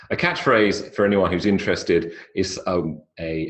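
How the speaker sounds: ordinary talk, nothing marked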